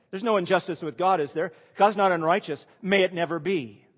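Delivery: preacher stressing that God is not unjust; emphatic denial. Acted. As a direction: declare it firmly from the pulpit. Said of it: "he's asking it".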